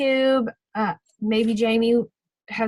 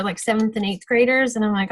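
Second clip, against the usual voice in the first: ordinary delivery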